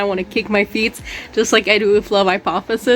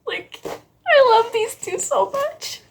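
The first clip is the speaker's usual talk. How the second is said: gushing squeal; 13 semitones higher, slower than usual. Real, not acted. Gushing over the couple.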